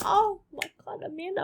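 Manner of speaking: high mock gasp of a worried mum; mum's exclamation. A hint of the panicked mum. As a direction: squeal it like a panicked mother.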